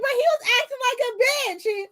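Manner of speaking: very high, amused protest; hammed-up high-pitched excuse; squealed self-defence. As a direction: plead your case in a squeal. Retells laughing self-defence to manager.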